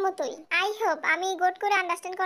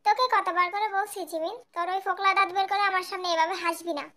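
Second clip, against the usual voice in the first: ordinary delivery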